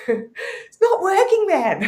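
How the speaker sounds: high, slow, laughing through the words; laughing complaint. Retold or imitated. She repeats the complaint to the shaman, laughing and drawn out.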